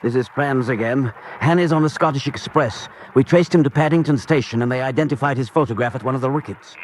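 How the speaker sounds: urgent report. Acted. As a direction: report the chase forcefully to the boss. Henchman reports urgently by phone.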